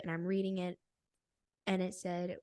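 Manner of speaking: normal talking voice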